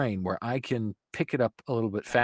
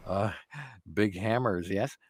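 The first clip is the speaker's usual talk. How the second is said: Jeff Goldblum impression; 5 dB louder than usual. Shown as a demonstration. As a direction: stretch the words, pausing on little hums, getting more excited. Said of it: "you kinda sound like Jeff Goldblum".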